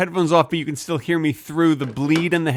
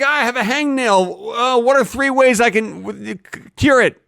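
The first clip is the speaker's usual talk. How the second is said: higher-pitched, halting mock questioner; puzzled member of public; 7 semitones higher, slower than usual. Imagined viewer with a hangnail asking, higher halting voice. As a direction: play someone haltingly asking for help. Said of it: "people are like".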